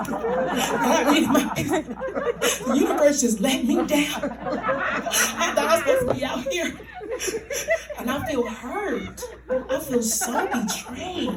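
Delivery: high-pitched mock crying; tearful self-pity. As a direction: wail it out, hammed-up and betrayed. Crying over pizza, feeling let down.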